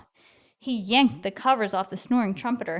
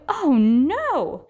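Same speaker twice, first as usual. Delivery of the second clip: alarmed; 6 semitones higher, 3 dB louder than usual. Acted. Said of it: "gasped Bartholomew".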